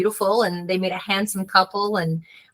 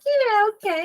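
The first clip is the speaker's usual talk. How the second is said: very high, uneasy "okay"; wary agreement; 14 semitones higher than usual. Retold or imitated. Woman humoring a friendly stranger at night, warily.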